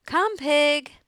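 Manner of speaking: loud, high livestock call; farmer calling pig. A narrator's reading. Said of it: "said Mister Zuckerman".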